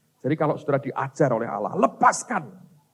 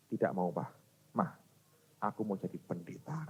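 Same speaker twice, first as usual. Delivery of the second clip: quiet, low imitated child; hushed, understated little confession; soft child's reply; 7 semitones lower, 14 dB quieter than usual. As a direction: answer the parents very softly. Retold reply of his small child.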